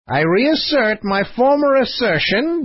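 high, emphatic philosopher voice; Copykeck plunging in. A narrator's reading. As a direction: launch straight in, pitched high. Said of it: "began Copykeck with a plunge".

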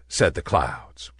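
ordinary delivery, nothing marked

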